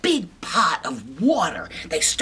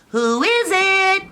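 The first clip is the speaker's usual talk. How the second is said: high, slow little-pig voice; squeaky pig voice; 14 semitones higher, 5 dB louder, slower than usual. Acted. Little pigs answering the wolf, high squeaky. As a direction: ask it in a tiny high pig voice. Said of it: "three little pigs say".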